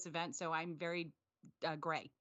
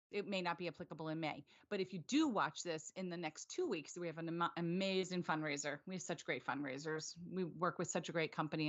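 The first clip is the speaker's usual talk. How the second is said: ordinary delivery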